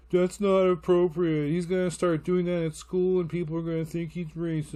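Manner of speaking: mock disapproving nag; scolding girlfriend. Girlfriend objecting to the accent voices, scolding. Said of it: "my girlfriend's starting to get all".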